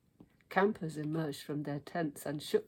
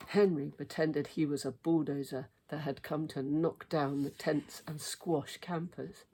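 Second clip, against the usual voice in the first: plain reading voice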